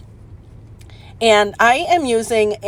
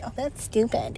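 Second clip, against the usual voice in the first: a scornful viewer, whispered; mocking whisper; 2 semitones higher, 9 dB quieter than usual. Crafter imitating a doubting viewer, whispered mockery. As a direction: mimic a sneering critic under your breath. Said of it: "somebody's gonna be watching this and be like".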